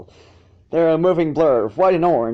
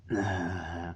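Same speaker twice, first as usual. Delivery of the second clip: blissful sigh; 7 semitones lower, 10 dB quieter than usual. Acted. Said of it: "She managed blissfully".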